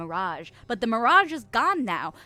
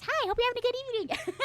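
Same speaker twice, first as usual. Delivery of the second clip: very high, fast cheery voice; squeaky greeting; 12 semitones higher, faster than usual. Acted. Streamer reading Jerry Mouse's hello, high chirpy voice.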